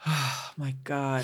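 soft, slow exhale of relief; relieved sigh. Retold or imitated. Recalling how a first drink felt, relieved. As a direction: breathe it out, letting tension drop. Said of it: "my shoulders just relaxed".

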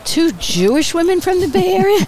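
a hammed-up gasp of disbelief; mock scandalized voter. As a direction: mimic the outraged voters, high and incredulous. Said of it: "people would say things like that to me".

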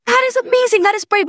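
very high, excited praise; gushing parental praise. Retold or imitated. Mom praising a teen for reaching out, gushing.

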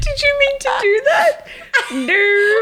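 high laughing, almost crying; laughing shout. Real, not acted. Laughing fit.

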